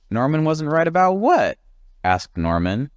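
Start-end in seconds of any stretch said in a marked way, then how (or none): 0.1-1.6 high puzzled voice. Acted. Norman voiced.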